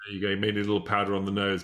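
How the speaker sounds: normal talking voice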